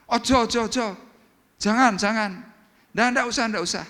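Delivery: high, loud, repeated protest; a rich boss flatly refusing to be paid, loud and high; insistent refusal. Acted.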